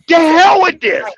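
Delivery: high, loud fed-up outburst. Retold or imitated. Quitting on women, high loud fed-up outburst. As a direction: spit it out like you're done with it all. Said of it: "I said".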